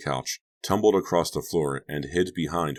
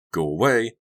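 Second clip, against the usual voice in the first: raised, louder furious dismissal; angry order; 7 semitones higher, 5 dB louder than usual. Acted. Furious babysitter sends prankster away, raised angry order. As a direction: send him away crossly.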